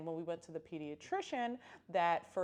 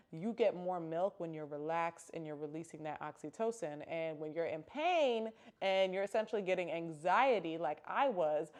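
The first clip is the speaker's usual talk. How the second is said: ordinary talk, nothing marked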